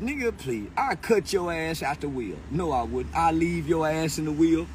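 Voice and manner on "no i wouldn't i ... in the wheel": usual speaking voice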